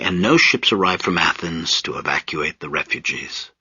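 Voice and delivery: ordinary delivery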